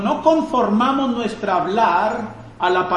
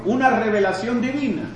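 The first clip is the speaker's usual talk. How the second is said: ordinary talk, nothing marked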